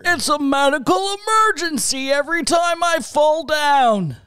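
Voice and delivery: put-on strained, breath-held old-man voice; high, squeezed old codger. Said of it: "are you holding your breath while you do that".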